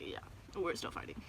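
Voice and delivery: hushed, resigned whisper. Retold or imitated. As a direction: say it under your breath, defeated. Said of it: "I was like".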